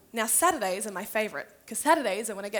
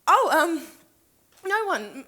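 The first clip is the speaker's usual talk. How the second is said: flustered; 4 semitones higher, 8 dB louder, slower than usual. Acted. Caught out between two friends.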